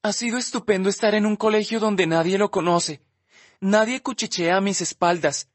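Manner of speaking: lightly voiced Olivia, wavering; tearful teen girl. Olivia confessing her shame to Justin, tearful.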